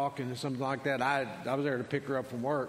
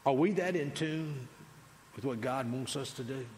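ordinary talk

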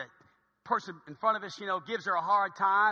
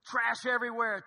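ordinary delivery

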